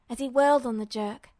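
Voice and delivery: plain reading voice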